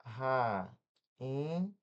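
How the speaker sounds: "Rainha" broken into Ra, i, nha with pauses; syllable by syllable. Shown as a demonstration. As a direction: split Rainha slowly, the i standing alone. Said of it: "se na próxima sílaba tiver N H".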